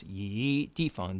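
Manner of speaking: slow YI turning upward into DEFENG; rising YI before DEFENG; rising tone change. Shown as a demonstration. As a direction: stretch YI upward, then say DEFENG. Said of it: "has to turn up".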